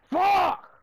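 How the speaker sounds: sudden loud high curse; furious shout. Real, not acted. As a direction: explode with rage. Killed in game.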